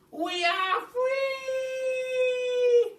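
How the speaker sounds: very high, long drawn-out cry of joy; joyful cry. Acted. Little monkeys escaping the cage cry out, high joyful cry. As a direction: sing out freedom at the top of the voice. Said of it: "cried the two little monkeys".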